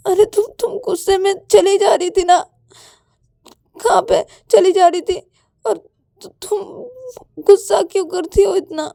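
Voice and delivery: high, loud, upset reproach; tearful protest. Acted. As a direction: complain back, hurt and worked up. Payal protests at Ananya's temper.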